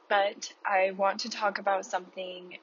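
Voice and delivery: normal talking voice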